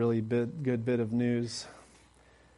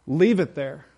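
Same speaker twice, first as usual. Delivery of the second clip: God's firm command; 3 semitones higher, 6 dB louder than usual. Retold or imitated. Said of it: "he says".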